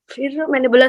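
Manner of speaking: ordinary talk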